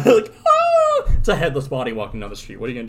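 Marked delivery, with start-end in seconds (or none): 0.5-1.1 very high, loud comic shriek; mock scream. Mother fleeing a headless body, mock scream.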